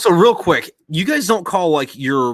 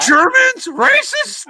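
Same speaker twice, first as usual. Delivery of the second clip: very high, louder squeaked sarcasm; sarcastic mock shock; 7 dB louder, much slower than usual. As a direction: squeak it in ironic disbelief.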